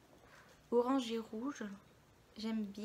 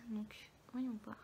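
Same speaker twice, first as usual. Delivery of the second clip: whispering; 6 dB quieter, slower than usual. Real, not acted. Whispered while testing palette.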